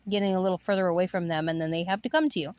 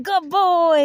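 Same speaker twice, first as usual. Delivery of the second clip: put-on high, louder good boy; praising the dog; 8 semitones higher, 5 dB louder than usual. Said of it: "I'm like".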